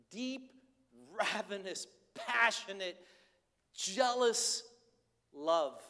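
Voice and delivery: rising passion word by word; building intensity. Real, not acted. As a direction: pile the words up with growing force. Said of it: "building list of intensities".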